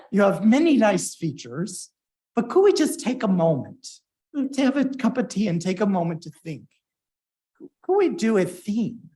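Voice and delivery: a fuller try at a mock British accent. Quoting Toby asking for a theme, mock British accent. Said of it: "I can't do the accent".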